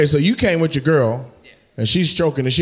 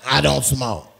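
mocking mimicry sinking lower; imitating audience member; 6 semitones lower than usual. Comic playing the man excusing himself to kids, mocking imitation. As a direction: mimic his excuse, pitched low at the end. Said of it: "'Cause he be like".

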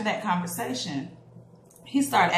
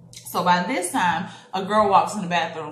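ordinary delivery

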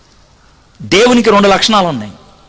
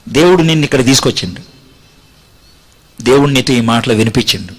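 altar call; 9 semitones lower than usual. Acted. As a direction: draw them in gently to come forward.

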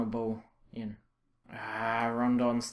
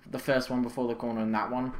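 ordinary delivery

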